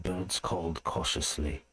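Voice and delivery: ordinary delivery, nothing marked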